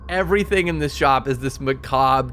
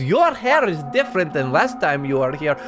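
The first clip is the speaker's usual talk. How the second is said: higher, fawning compliment voice; higher, gushing compliments on her hair; Blinsky the toymaker; 5 semitones higher than usual. Acted. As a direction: flatter them like an eccentric shopkeeper. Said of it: "He says".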